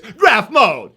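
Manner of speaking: loud hype call; hype announcement. Acted. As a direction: announce it like a show feature. Announcing his camera mode.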